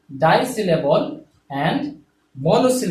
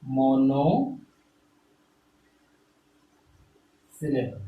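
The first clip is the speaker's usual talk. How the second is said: very slow, low and soft; writing-pace murmur; 6 semitones lower, 5 dB quieter, much slower than usual. Real, not acted. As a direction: draw it out syllable by syllable while writing. Writing the term out while saying it. (লিখতে লিখতে ধীরে, নিচু স্বরে টেনে বলা)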